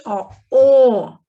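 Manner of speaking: a loud closed ó, o held out; closed O sound. Shown as a demonstration. Teacher demonstrates the closed O sound to pupils. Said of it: "O som do O".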